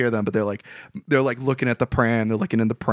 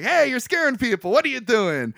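high-pitched retold telling-off; mock angry scolding; 7 semitones higher than usual. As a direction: scold lightly as if indignant. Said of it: "Sandra Bullock gets all angry".

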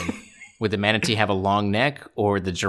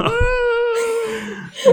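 spooky woo. Acted. Ghost sound joke.

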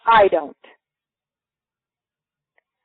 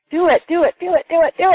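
higher, pushing chant of do it; an urgent higher chant of do it; insistent urging; 7 semitones higher, 3 dB louder, faster than usual. Retold or imitated. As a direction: nag like an insistent inner voice. Inner prompting urges her.

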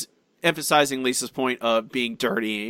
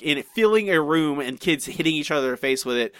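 normal talking voice

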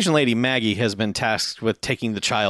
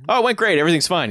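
fake cheerful; 7 semitones higher, much faster than usual. Character covering up, acting cheerful.

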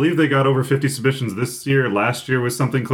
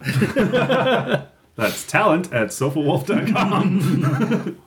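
laughing; 7 semitones higher, much slower than usual. Real, not acted. Reads email laughing.

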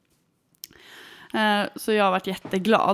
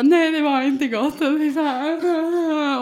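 slow, higher, louder imitation of a sobbing child; mock crying; 8 semitones higher, 7 dB louder, much slower than usual. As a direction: whine it like a crying kid. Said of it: "bara gråter".

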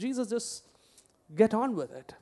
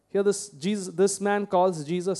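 ordinary delivery

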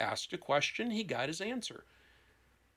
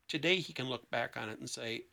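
ordinary talk, nothing marked

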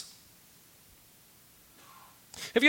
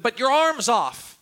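incredulous knight; 6 semitones higher, 5 dB louder, slower than usual. Retold or imitated. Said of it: "He said".

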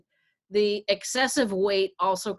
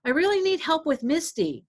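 husband asking help; 9 semitones higher than usual. Retold or imitated. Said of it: "he's like".

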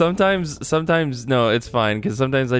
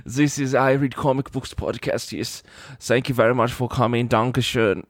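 mock German accent. Host finishes the German podcast intro, mock German accent. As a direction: announce it in a measured German accent. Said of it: "gonna do it in German".

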